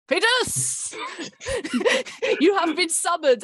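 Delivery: high, laughing mock proclamation; grand summons. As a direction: announce it like a herald, giggling. Mock summons to cast member.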